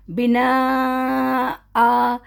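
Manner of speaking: بنا آ with a long drawn آ and no nun; pausal recitation. Shown as a demonstration. Teacher showing the pause on بنا آ. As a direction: stop on بنا and stretch آ for two counts. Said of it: "اب میں یہاں پہ وقف کر رہی ہوں".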